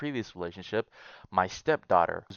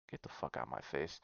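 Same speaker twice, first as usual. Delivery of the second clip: muttered dismissal; 8 semitones lower, 16 dB quieter than usual. Real, not acted. Dismissing the poster.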